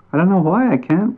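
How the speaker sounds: a small creature sorry he can't; little bat. A narrator's reading. Bat voiced, frustrated.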